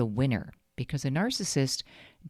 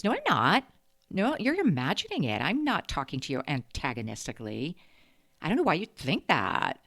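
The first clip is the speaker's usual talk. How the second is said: mocking, higher-pitched snotty retort; 4 semitones higher than usual. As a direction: imitate someone snottily denying everything. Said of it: "like that snotty tone".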